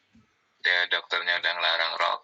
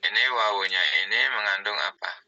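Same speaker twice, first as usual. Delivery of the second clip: ordinary delivery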